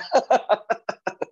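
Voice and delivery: high burst of laughter; hearty laugh. Real, not acted. Speaker laughs at imagined partner's complaint, hearty laugh. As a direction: laugh out at your own example.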